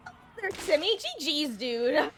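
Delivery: ordinary delivery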